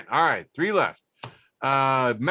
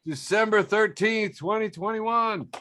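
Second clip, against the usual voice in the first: show sign-off; 7 semitones higher than usual. Acted.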